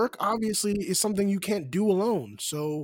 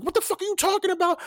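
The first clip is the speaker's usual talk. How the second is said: higher, fast mock outrage; a touch of heated denial; mild mock heated retort; 9 semitones higher, faster than usual. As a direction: snap back like a caught girlfriend, lightly acted. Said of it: "she's getting heated with him".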